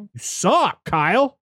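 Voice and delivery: a high, teasing put-down; mock taunt. Sarah mocks Kyle about Pops, in a high teasing voice. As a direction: needle him in a high voice. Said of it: "And she's like".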